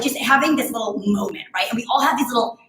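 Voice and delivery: ordinary talk, nothing marked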